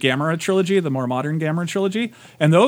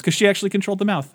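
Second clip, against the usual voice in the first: ordinary talk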